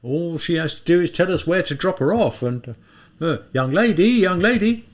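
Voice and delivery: put-on an older policeman clucking over the girl; policeman character.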